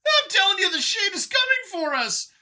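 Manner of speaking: very high, loud alien shouting; panicked Howler. A narrator's reading. Lead Xeno yelling about the Shade, loud panic. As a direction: yell in frantic fear.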